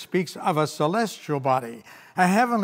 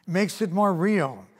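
ordinary delivery

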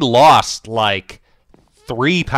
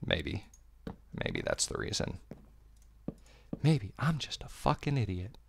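whispered mutter; 14 dB quieter than usual. Real, not acted. Muttering to herself.

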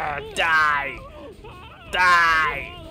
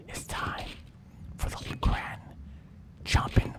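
hushed conspiratorial whisper; a shark whispering to the audience; sly whisper; 7 dB quieter than usual. Acted. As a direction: whisper the secret behind their backs. Shark confides secret to audience.

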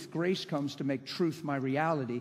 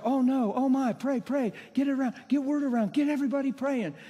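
high, loud, hurried panic; mock hysteria; 9 semitones higher, 4 dB louder than usual. Preacher mimicking frantic prayer chains, mock hysteria. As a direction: imitate people frantically spreading the alarm. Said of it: "there's this hysteria around the circumstance".

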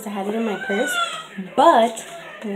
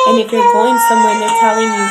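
ordinary delivery

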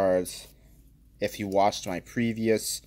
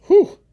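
impressed whistle; 14 dB louder than usual. Real, not acted. Whistle at value.

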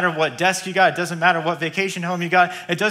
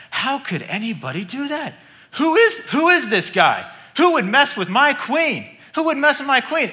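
outraged king; 7 semitones higher than usual. Acted. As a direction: demand to know who dared.

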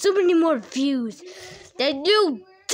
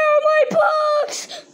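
high, slow anguished cry; panicked wail; 10 semitones higher, 4 dB louder, slower than usual. Acted. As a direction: wail it in horror. Discovers missing books, cries out.

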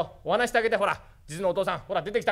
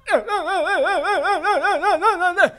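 toothless tongue twister try; 11 semitones higher, 5 dB louder, slower than usual. Acted. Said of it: "生麦、生米、生卵って言ってみ".

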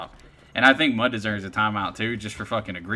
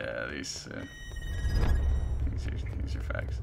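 whispering; 10 semitones lower, 7 dB quieter than usual. Real, not acted. Whispered comment while streaming.

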